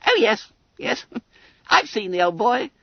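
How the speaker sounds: high, squeaky schoolboy chirp; breezy, high-pitched boy with a chuckle; careless Billy Bunter. A narrator's reading. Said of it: "Bunter nodded carelessly".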